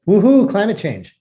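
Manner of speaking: high, mock-celebratory cheer; sarcastic. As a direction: fake a gleeful woohoo, dripping with irony. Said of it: "that's sarcasm".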